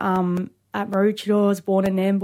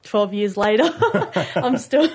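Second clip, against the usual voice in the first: laughing through the words; 5 semitones higher, 5 dB louder than usual. Real, not acted. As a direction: chuckle as you say it. Laughing while telling.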